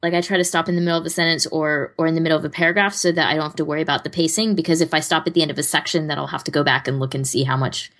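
normal talking voice

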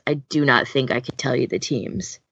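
normal talking voice

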